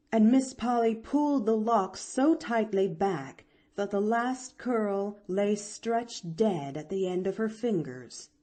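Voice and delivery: usual speaking voice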